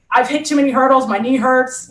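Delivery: ordinary talk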